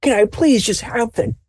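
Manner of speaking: put-on an old man at the end of his patience; exasperated grandfather. Grandfather demanding his tea after the noise dies down, high and exasperated. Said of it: "your grandfather is just like this".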